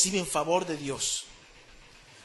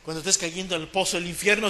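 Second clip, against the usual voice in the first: ordinary delivery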